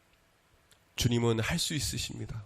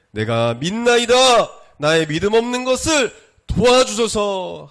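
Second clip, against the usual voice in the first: loud, slow, high cry for help; 10 semitones higher, 10 dB louder, slower than usual. A narrator's reading. Preacher reading the father's plea for his son, crying out. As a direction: draw out the plea in a rising shout. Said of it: "소리 질렀다고 했어요".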